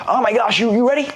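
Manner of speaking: high, overexcited salesperson; ecstatic. Shown as a demonstration. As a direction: gush like a pushy salesman. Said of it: "if you go in really ecstatic".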